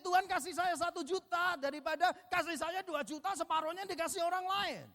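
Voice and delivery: mock childish prayer. Mocking congregation's prayer.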